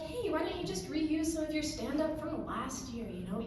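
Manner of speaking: ordinary talk, nothing marked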